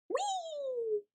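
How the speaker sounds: very high, thin squeal of delight; squeaky hamster whee. Acted. Hamster sliding down a cardboard tube, high gleeful squeal. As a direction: squeal like a tiny animal on a slide.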